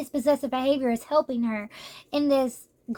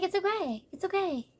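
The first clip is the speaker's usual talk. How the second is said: higher, softer comforting voice; tender mock consolation; reassuring; 6 semitones higher, 6 dB quieter than usual. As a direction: gently soothe someone who is crying. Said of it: "he's like".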